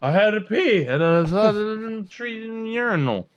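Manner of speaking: hammed-up drunk pleading; drunk excuse. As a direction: whine your excuse like a caught drunk. Drunk offender excuses himself.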